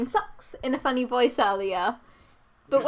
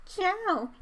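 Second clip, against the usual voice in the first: ordinary talk, nothing marked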